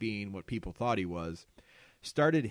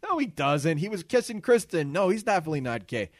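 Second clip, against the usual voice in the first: heated retort; 6 semitones higher, 8 dB louder than usual. Retold or imitated. Acted argument among guys.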